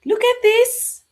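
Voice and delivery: loud excited pointing out; excited call. Shown as a demonstration. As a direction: grab the kids' attention. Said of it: "calling children's attention".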